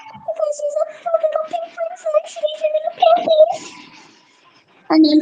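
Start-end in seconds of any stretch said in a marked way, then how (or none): 0.1-3.3 put-on cutesy baby voice. Cutesy voice for pink bunny.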